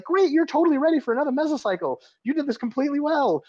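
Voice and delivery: high-pitched sarcastic enthusiasm; mock praise. Coach sarcastically praising a client who feels awful, high mock-cheerful.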